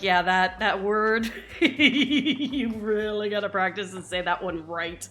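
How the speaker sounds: imitating the tutor, laughing through the words. Tutor teasing her student over the mistaken word, laughing. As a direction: tease the student while cracking up.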